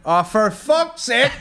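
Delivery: high, loud, drawn-out groan of annoyance, half laughing; mock exasperation. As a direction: let the exasperation burst out slowly, hammed up. Acting out exasperation at surprise arrival.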